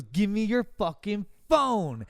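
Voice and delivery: slow, forceful mock threat; retold demand. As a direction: act out a robber demanding, stretching the words. Retelling a mugger's demand.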